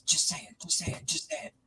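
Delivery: coaxing whisper; whispered urging. Retold or imitated. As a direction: whisper it like egging her on.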